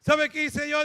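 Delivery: ordinary talk, nothing marked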